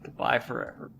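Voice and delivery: soft, breathy, dramatic goodbye; mock whispered farewell. Shown as a demonstration. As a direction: whisper it like a tragic parting. Mock farewell to silent NPC.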